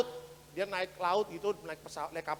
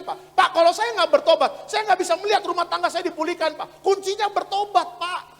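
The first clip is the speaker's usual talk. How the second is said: high, loud, pleading testimony; imitating earnest testifiers; 10 semitones higher, 12 dB louder than usual. Church members testifying to their pastor, imitated earnestly. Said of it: "Mereka mengatakan".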